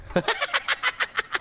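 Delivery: high giggling laugh; high giggle fading down; cheeky laugh. Acted. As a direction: crack up at your own joke. Laughing at own joke.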